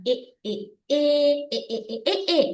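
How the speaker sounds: "ஏ" repeated as the sound of letter E; phonics sound drill. Shown as a demonstration. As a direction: chant "ஏ" in separate short and held bursts. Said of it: "ஈல உச்சரிப்பு".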